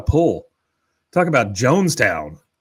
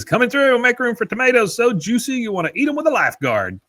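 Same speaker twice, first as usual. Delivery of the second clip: high-pitched hawker's call; mock kid vendor calling out, pitched high; 11 semitones higher than usual. Retelling Bobby touting tomatoes to the crowd, high calling-out voice. Bobby hawking produce in retold episode.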